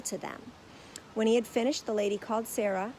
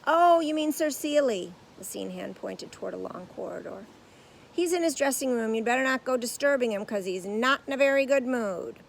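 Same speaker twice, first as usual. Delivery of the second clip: stagehand voice; 4 semitones higher, 7 dB louder than usual. A narrator's reading.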